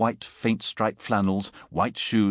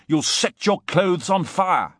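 loud, high, stretched-out alarm; cried warning; 8 semitones higher, 5 dB louder, slower than usual. A narrator's reading. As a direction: shout the danger slowly and urgently. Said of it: "I cried".

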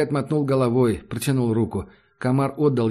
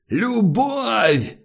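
much higher desperate yell; choked high yell; 10 semitones higher than usual. A narrator's reading. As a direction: scream it while pinned to a wall. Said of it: "заорал притиснутый к стене скинхед".

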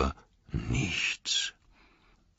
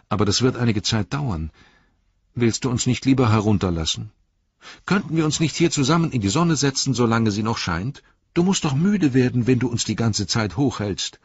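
high, very fast, loud pleading; pleading hobbit; 8 semitones higher, 6 dB louder, much faster than usual. Acted. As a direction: coax the Ent to set them down. Hobbit Merry pleads with the Ent.

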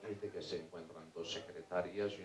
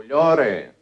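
loud call to a man in the water; acted shouted advice; 12 dB louder than usual. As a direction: call it out firmly from the shore. Passer-by calls to drowning man.

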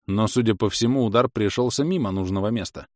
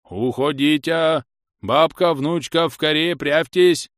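higher, louder urgent cries; shouting old man; 7 semitones higher, 6 dB louder, slower than usual. A narrator's reading. Scene: grandfather yells at his family to hide, urgently. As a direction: order the women away.

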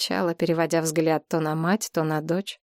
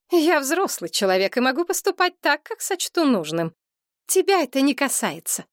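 indignant mother; 7 semitones higher than usual. A narrator's reading. Mother's lines in the quarrel.

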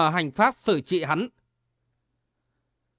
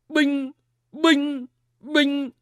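high, ringing "binh" for each blow; imitated impact sounds; 7 semitones higher, much slower than usual. A narrator's reading. Three palm strikes hit the cult leader, imitated impacts. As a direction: voice three separate hits, pitched high. Imitated blows landing in fight.

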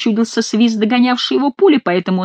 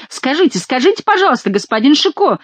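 high, urgent call; crying out; 7 semitones higher than usual. A narrator's reading. Breathless monk calls after galloping Chicot, crying out. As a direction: call out insistently in raised pitch. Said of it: "закричал он".

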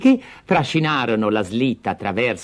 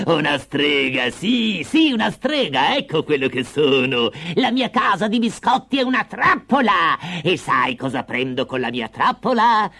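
triumphant witch with high, swooping pitch; gleeful witch; 7 semitones higher than usual. Acted. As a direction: gloat over the trap, shrieking at the end. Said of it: "la vecchia strillò di gioia". (strega che esulta trionfante)

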